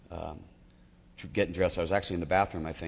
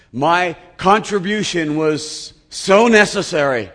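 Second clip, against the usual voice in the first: slow, loud, much higher pompous self-mockery; loud, high mock pride; mock grandiose; 8 semitones higher, 11 dB louder than usual. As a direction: puff himself up in mock importance. Mocking his own self-importance.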